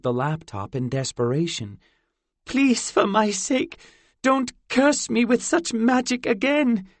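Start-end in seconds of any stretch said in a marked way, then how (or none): 2.4-6.9 put-on pleading fairy girl. A narrator's reading. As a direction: beg him not to curse her. Zinnia pleading.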